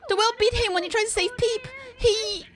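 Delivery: put-on a small boy voice, high and quick; high child voice. Ship's kid begging about the captain, high child voice.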